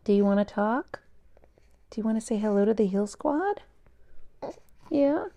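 put-on high, sing-song baby talk. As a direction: coo sweetly at the baby. Talking to the baby.

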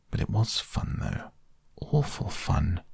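hushed, half-whispered defiance; mutinous mutter. A narrator's reading. Toad muttering to himself while Ratty lectures, hushed. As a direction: mutter it under your breath, rebellious. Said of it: "he kept saying to himself mutinously".

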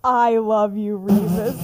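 loud, hammed-up declaration; mock love declaration. Imagined James waking up to tell Remus, mock declaring. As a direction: blurt it out loudly as a joke.

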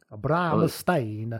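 very slow Italian-accented Bramstein. Shown as a demonstration. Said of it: "How would you do that?".

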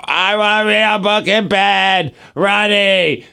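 put-on slow, whiny little-girl demand; imitating whiny toddler. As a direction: drag it out like a stubborn toddler. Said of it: "she's just like".

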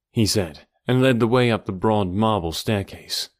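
plain reading voice

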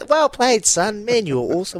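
high, sweet praise from a parent; mock proud parent. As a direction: gush like a proud mum. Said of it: "I was like".

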